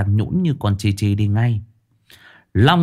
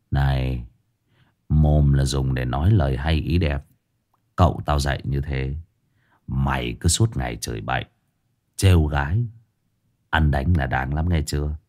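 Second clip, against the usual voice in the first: lower, menacing tough-guy voice; tough guy lecturing; 7 semitones lower, 3 dB quieter than usual. A narrator's reading. Hải Nghẹo scolds the beaten thug, low and menacing. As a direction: talk down to him low and cold.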